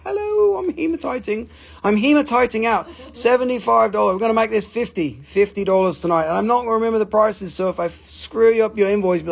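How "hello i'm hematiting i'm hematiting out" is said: high hello, dropping as it goes; playful high-pitched mineral voice; chirpy talking crystal. Pretend. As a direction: give the little crystal a high cartoon voice.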